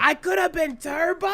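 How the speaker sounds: mock duck voice whining that he could have been Turbo; DuckTales duck voice. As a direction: squawk it out like a cartoon duck. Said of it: "he's doing the duck".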